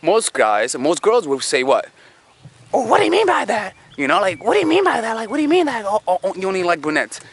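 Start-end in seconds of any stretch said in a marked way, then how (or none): 2.7-7.2 high, rushed and defensive, fishing for an explanation; mock a touchy girl who takes the bait; flustered girl. Girls reacting to a guy's jab.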